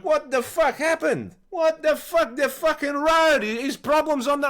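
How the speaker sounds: put-on high, loud accented drunken ranting; slow, outraged drunk impression; drunk stepdad. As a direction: imitate a pissed, indignant Hungarian stepdad. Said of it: "because he was pissed".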